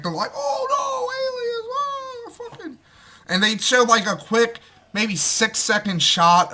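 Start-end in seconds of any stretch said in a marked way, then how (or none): 0.3-2.8 high, shrieking mock panic; panicked civilians. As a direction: squeal it like a fleeing bystander in terror. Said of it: "everybody's panicked".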